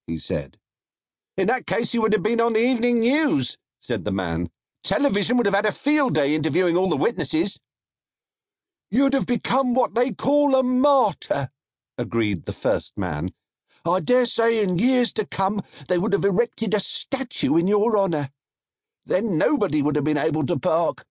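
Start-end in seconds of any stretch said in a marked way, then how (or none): 1.3-7.6 higher, fast and eager man from the crowd. A narrator's reading. Narrator voices man in crowd. 8.9-11.5 higher, lightly put-on voice of a man in the crowd; a hint of a knowing bystander; first man. A narrator's reading. 13.8-21.0 higher, lightly put-on voice of a man in the crowd; a hint of a knowing bystander; first man. A narrator's reading.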